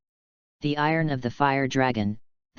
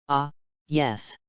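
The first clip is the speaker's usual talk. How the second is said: trembling voice. A narrator's reading. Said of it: "Gray said in a trembling voice".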